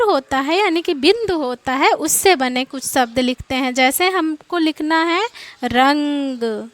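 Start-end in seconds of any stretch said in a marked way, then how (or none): 5.6-6.7 रंग drawn out slowly, nasal ng before g; slow spelled-out demo. Shown as a demonstration. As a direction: say रंग slowly, carefully stretching each sound. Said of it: "देखिए रा लिखे, ग लिखे".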